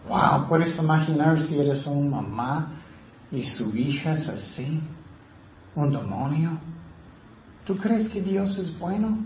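usual speaking voice

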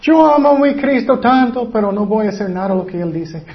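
high, gushing mock devotion, easing down quickly; hammed-up adoration, then a quick throwaway admission; mock professed believer. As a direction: imitate someone declaring love a little too much. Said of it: "Hay muchas personas que dicen".

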